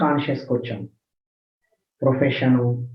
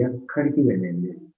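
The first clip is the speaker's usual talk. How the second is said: ఎక్కడికి వెళ్ళేది లేదు said lower and softer; a lowered, softened repeat of the maxim; hushed and low; 6 semitones lower, 6 dB quieter than usual. Shown as a demonstration.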